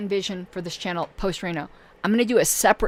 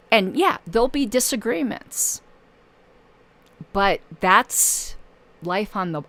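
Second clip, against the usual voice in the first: ordinary delivery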